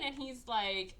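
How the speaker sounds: ordinary delivery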